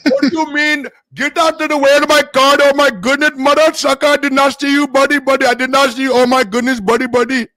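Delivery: put-on very high, loud Indian-accented protest; mock Indian accent. Driver protesting to another motorist, very high mock Indian accent. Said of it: "hard to go with the Indian and Nigerian".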